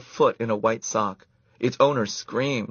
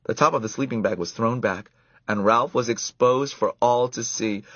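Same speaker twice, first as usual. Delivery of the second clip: usual speaking voice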